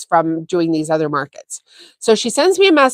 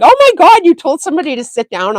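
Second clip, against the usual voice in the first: much higher and louder, delighted disbelief; imitating her friend's shocked glee, pitched way up; amazed friend; 7 semitones higher, 6 dB louder than usual. Friend at the market reacting to the story, excited squeal. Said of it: "she was like".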